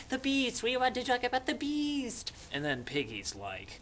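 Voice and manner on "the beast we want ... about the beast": high, thin put-on kids' voices; whiny children. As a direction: pipe up like anxious little kids. Frightened children's voices, acted.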